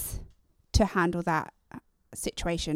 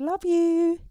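sing-song love you; 9 semitones higher, 5 dB louder than usual. Retold or imitated. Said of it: "when we said".

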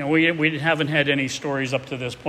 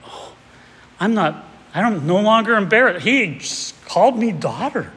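overjoyed; 6 semitones higher, 5 dB louder than usual. Retold or imitated. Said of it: "I'm thinking she's just so great".

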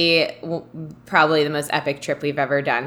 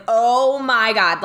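retold excitement; 4 semitones higher, much slower than usual. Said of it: "I got so excited".

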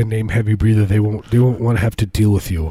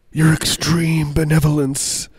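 mock grandeur; 6 semitones higher than usual.

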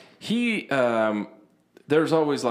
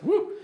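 sudden high-pitched whoop; short, high "woo" outburst; imitated tic whoop. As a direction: blurt out a mock tic, very high. Mimics a tic after mentioning Tourette's.

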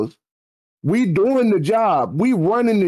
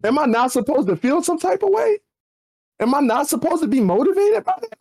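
1 incredulous retort; 10 semitones higher than usual. Retold or imitated. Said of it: "So I'm just like".

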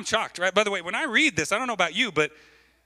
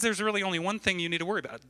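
fast, higher disbelieving quote; mock incredulity, quick and raised; incredulous repeat; 4 semitones higher, much faster than usual. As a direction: rattle it back as if it's crazy. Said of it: "That's crazy, right?".

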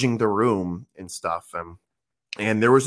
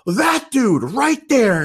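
high, loud, slow mock yell; retold scream; 12 semitones higher, 5 dB louder, much slower than usual. Girl singling out the new guy, mock screaming. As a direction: call it out across the room, drawn out. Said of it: "and she screams".

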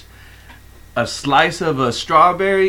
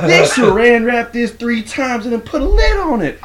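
higher, lightly mock complaining; imitating Willie; 8 semitones higher than usual. Coworker mimics Willie complaining about the wrap, a light high whine. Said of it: "He was like".

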